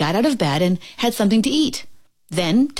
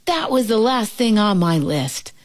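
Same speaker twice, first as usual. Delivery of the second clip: ordinary delivery, nothing marked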